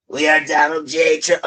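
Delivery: a lightly voiced Trump with a strange timbre; a mild attempt at a Trump voice; Trump impression. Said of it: "I just can't do his fucking voice".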